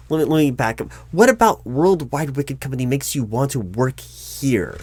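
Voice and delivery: normal talking voice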